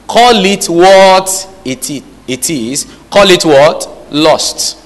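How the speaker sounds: fervent preaching, slow and emphatic. Acted. Preacher urging the church to name lust. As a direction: drive the point home to the congregation.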